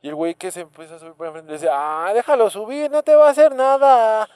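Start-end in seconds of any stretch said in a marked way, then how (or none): 1.7-4.3 slow, rising, much higher and louder sly street-tough voice; mock thug tone. Retelling a bus robbery, mimicking the robber's slow sly tone. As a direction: imitate a shady guy on the bus, slow and sly. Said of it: "Pero así con ese tono".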